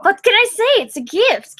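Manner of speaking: high, pleased with himself; cocky. Acted. Gilbert bragging to his friend, high and cocky. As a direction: show off like it's nothing. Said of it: "Gilbert said, grinning".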